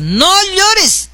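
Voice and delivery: high-pitched, drawn-out "no llores"; acted exhortation. Telling a crying woman to stop, exhorting in a high voice. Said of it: "A mí me está contando llorar".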